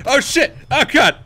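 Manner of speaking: high, loud laughing yelp; laughing shout. Real, not acted. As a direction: burst out laughing in alarm. Laughs, then nearly killed.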